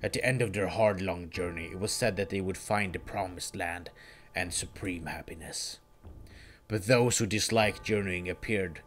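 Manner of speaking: low, soft, coldly superior villain; cold Sephiroth voice. Acted. Villain explaining the planet's history, low and cold. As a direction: lecture him with icy contempt.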